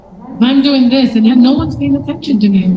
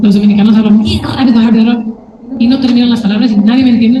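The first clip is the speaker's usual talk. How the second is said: fast, tumbling Spanish; rapid-fire; much faster than usual. Shown as a demonstration. Teacher showing how fast Dominicans talk, rapid-fire. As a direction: rattle it off without finishing words.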